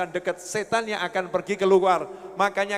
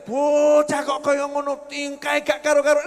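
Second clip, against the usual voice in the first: mild mock scolding; 4 semitones higher, 4 dB louder than usual. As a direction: tell off a child, hammed-up. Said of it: "Nggak dibilang".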